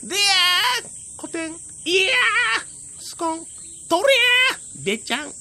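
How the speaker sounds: high battle cries, then a plop; sumo grunts. Acted. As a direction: yell as you charge and get thrown. 小天狗が相撲で投げられる. (相撲の掛け声と投げられる音)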